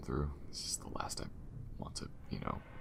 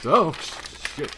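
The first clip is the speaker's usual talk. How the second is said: sudden high, loud yelp of fright; startled curse; 12 semitones higher, 15 dB louder, much slower than usual. Real, not acted. Exploring a creepy ship, startled by what's ahead: a high, loud yelp of fright. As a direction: jump and blurt it out.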